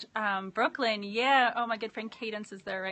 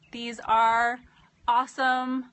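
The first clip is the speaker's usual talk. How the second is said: usual speaking voice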